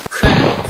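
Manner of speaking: sharp loud mouth gunshot; gunshot crack. Acted. Gunshot during a battle scene.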